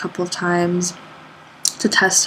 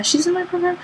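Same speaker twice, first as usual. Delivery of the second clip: quoting her own surprise; 11 semitones higher than usual. Retold or imitated. Surprised realising who Nikki is.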